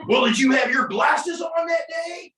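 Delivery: hammed-up, high, drawn-out challenge; cross-examining attorney. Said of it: "when you cross-examine me, you're like".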